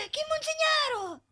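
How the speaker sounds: high, slow, offended gasp; shocked gasp. Acted. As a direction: gasp as if deeply insulted. Gasping in shock at insult.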